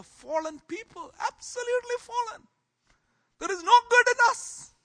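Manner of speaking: high, loud fervent cry; preaching shout. Real, not acted. Pastor crying out to the church that we are fallen, loud and high. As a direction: cry it out with full conviction.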